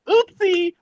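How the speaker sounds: a hammed-up cutesy oopsie; mock cutesy oopsie. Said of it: "should he have been like".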